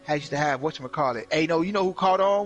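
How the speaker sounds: ordinary talk, nothing marked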